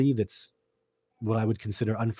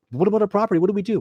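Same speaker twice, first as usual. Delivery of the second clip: high, louder imitation of anxious owners; mock a couple's anxious protest, pitched up; worried property owners; 9 semitones higher, 5 dB louder, much faster than usual. Acting out worried property owners' reply.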